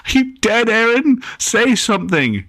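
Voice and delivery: a frightened brother shaking his sister; high panicked child. A narrator's reading. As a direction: plead like a scared kid. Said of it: "she yelled in anger".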